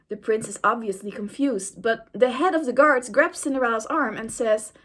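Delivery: usual speaking voice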